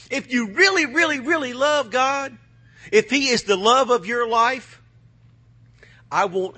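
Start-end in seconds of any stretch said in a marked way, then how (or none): 0.1-4.8 fiery preaching. Acted. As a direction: preach it with full force. Preacher building to a peak.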